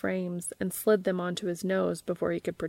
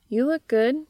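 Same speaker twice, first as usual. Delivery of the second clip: higher, louder, pleased compliment; bright approving; 6 semitones higher, 6 dB louder than usual. Acted. Anna reassures nervous Dean about his outfit, approving. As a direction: gush it out warmly.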